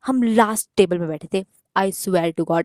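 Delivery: normal talking voice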